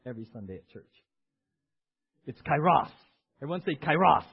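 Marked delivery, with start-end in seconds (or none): 2.4-2.9 kairos with a loud punch on the second syllable; kairos, second syllable belted out; stress on second syllable. Shown as a demonstration. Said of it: "the emphasis is on the, the second syllable". 3.8-4.2 kairos, loud burst on the last syllable; gusto on second syllable. Shown as a demonstration. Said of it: "say it with some gusto".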